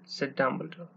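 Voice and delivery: ordinary delivery, nothing marked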